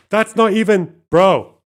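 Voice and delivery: high, loud gasp into an indignant cry; gasp, then protest in outrage; outraged gasp. Real, not acted. Loses the puzzle, outraged.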